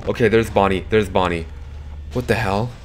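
ordinary delivery

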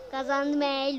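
loud victory cheer; loud celebration. Real, not acted. Gamer cheering loudly at a victory. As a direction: yell the win out loud.

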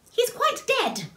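high-pitched, blunt little character; matter-of-fact. A narrator's reading. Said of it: "Little My said matter-of-factly".

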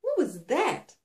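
startled question. A narrator's reading. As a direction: ask it as if just knocked back. Shaken heroine demands an explanation.

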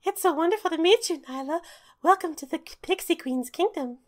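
high, bright fairy voice; squeaky little fairy greeting; tiny pixie voice. Acted.

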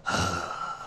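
low, soft acted sigh; disappointed sigh. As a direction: sigh as the frustrated alchemist. Alchemist dismayed at spilled potion.

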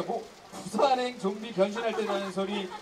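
normal talking voice

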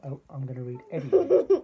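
loud laughing correction. Real, not acted. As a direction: laugh through the line.

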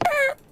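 high-pitched squeak noise; squish noise. Retold or imitated. Imagining Freddy's nose being squished, high squeak. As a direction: let out a tiny high squeak. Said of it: "and it goes".

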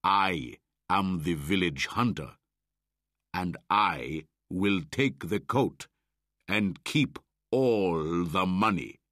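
loud, boastful hunter's claim; shouting. A narrator's reading. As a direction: lord it over the boy. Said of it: "shouted Buldeo".

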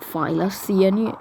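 usual speaking voice

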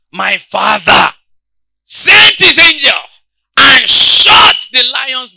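slow, high, loud preaching; preaching at full force. Acted. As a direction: drive each word home slowly.

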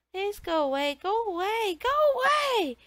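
put-on terrified boy screaming at the door. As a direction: panic climbing into a full scream.

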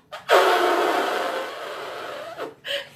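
long buzzing elephant trumpet, high; drawn-out imitation elephant call. Shown as a demonstration. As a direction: trumpet like an elephant through the lips. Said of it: "gonna try to do an elephant".

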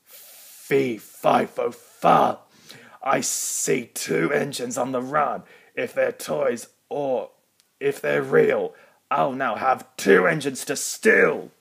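thunderous giant roaring his rhyme; booming giant. Acted. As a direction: boom it out like a huge giant. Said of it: "His thunderous voice boomed".

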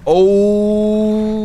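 sudden loud high oh; startled exclamation; surprised shout. Real, not acted. Altar reveal.

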